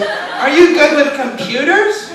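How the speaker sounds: high, slow mock nurse voice; challenging nurse. Nurse challenging the patient about computers, high and slow. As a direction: draw out the challenge.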